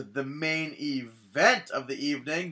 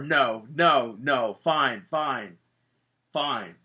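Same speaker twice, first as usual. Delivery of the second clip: slow delayed repeats; much slower than usual. Retold or imitated. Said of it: "I like your delay".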